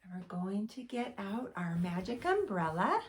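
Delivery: ordinary delivery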